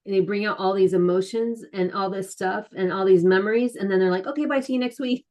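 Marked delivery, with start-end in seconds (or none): 4.3-5.2 higher, mock-cheery brush-off; breezy dismissal. Therapist sends client off after stirring up emotions, breezily dismissive. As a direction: imitate a breezy therapist ending a session. Mimics therapist's brisk send-off.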